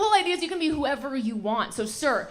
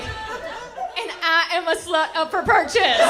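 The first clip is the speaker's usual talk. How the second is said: louder, higher, slow panicked announcement; panicked reply; 6 semitones higher, 5 dB louder, slower than usual. Pretend. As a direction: blurt it out in a panic, playing along. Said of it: "I panicked".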